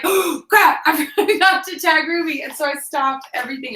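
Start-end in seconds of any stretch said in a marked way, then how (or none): 0.0-2.4 high panicked yelp; a quick burst of panic. Retold or imitated.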